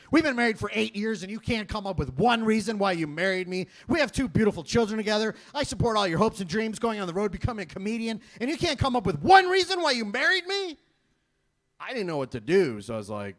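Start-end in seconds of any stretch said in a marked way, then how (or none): 0.1-10.8 loud, high, escalating tirade; retold screaming wife. Wife berating husband over marriage, angrily screaming. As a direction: imitate outrage building to a yell. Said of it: "she started screaming at me".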